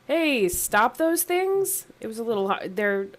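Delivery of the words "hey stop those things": lightly mimicked high, slow holler; a mild mock call, drawn out; imitated ogre call. Player checking what the ogres yelled, lightly imitated call. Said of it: "the guys in front were like".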